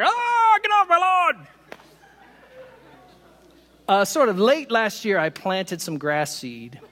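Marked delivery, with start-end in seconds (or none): 0.0-1.5 high, loud mock yell at the birds; cranky neighbor hollering off the porch; get off my lawn yell. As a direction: shout them off the lawn like a grumpy neighbor. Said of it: "I'm your neighbor who runs out there like".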